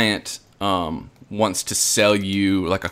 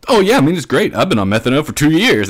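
eager boast; 5 semitones higher, 4 dB louder, faster than usual. Retold or imitated. Eager kid boasting about methadone.

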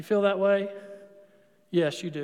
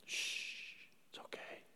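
whisper; 22 dB quieter than usual. Acted.

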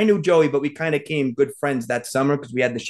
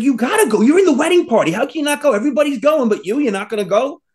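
high, mock-incredulous pleading; retold argument, pitched up and insistent; exasperated urging; 10 semitones higher, 3 dB louder than usual. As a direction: badger a friend who won't budge.

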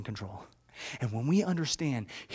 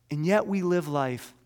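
normal talking voice

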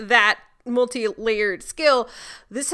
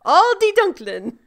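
higher, louder repeat; emphatic German title; 5 semitones higher, 6 dB louder than usual. Pretend. Host insists on the German episode title, high and emphatic. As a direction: announce it high and loud.

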